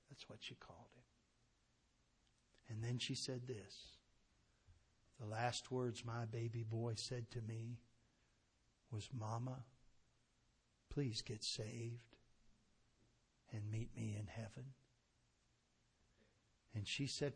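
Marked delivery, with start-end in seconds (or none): 5.2-14.7 soft, slow, grief-heavy telling; grieving mother. Retold or imitated. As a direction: hush down as she speaks of her buried boy. Mother repeats dying son's words.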